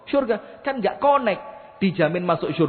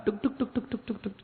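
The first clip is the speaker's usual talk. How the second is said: pecking bird sound; 11 dB quieter than usual. Retold or imitated. Preacher mimics a bird pecking grain, soft and light. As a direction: tap it out lightly like a beak.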